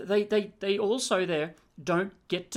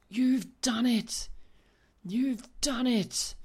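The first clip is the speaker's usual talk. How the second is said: inner voice; 6 semitones higher, much slower than usual. Acted. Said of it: "A little voice inside your head goes".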